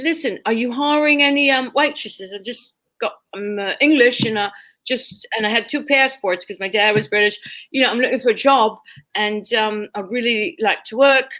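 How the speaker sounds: put-on British accent, asking for work; job-seeker faking a British accent. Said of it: "as a waitress with a British accent".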